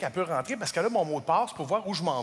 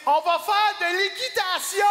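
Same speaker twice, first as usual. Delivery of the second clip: high, loud thrilled shout; thrilled announcement; 12 semitones higher, 5 dB louder than usual. Retold or imitated. Girlfriend reveals the horse outing in a high thrilled shout. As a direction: announce it like a gift.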